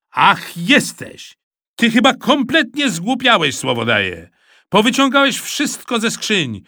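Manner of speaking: loud, high scolding; father scolding his son over the mess; angry father. A narrator's reading. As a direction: tell him off for the mess.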